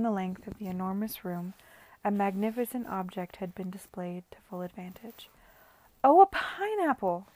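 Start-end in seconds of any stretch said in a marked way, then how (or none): 6.0-7.3 high, loud delighted cry. Acted. Guest crying out in delight at the pineapple, high and loud. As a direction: gasp with delight at the fruit. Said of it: "cried someone".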